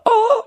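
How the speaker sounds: high squeaky oh imitating the noise; voice-cracked oh. Speaker re-creates the oh from the light, voice cracking. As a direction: let an oh crack sky-high. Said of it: "that was a bad voice crack".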